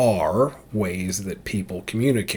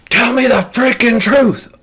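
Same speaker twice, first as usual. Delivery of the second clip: loud, higher, "frickin'" spat out angrily; aggressive demand; 12 semitones higher, 8 dB louder than usual. Shown as a demonstration. Demo of aggressive way to confront a liar, loud and angry. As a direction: bark it at a liar.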